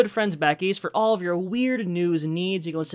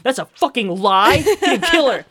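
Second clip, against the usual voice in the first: louder and rising higher into an indignant protest; indignant outburst; 5 semitones higher, 7 dB louder than usual. Retold or imitated. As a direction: snap back, offended for the show. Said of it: "Murderbot's like".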